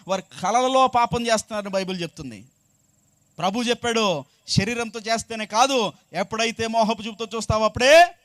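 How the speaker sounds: loud scripture proclamation; full-force preaching. Real, not acted. Preacher cites the Lord on lustful looking, full-force preaching. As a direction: ring the words out over the congregation.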